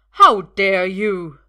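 very loud, slow snarl; loud slow furious snarl. A narrator's reading. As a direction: snarl in outrage. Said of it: "Uther snarls".

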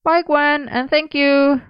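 high-pitched, slightly sheepish thanks. A narrator's reading. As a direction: call out thanks a little sheepishly. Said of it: "Merlin added somewhat sheepishly".

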